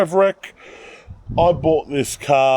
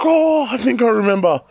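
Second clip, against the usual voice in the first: excited sister; 9 semitones higher than usual. Retold or imitated. Sister recalls buyer's name.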